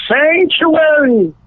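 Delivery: high, drawn-out mock cry for refuge; retold yell. Prankster on the altar fleeing campus police, high mock yell. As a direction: imitate a yell, pitched high and stretched out. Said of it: "he ran up on the altar and yelled".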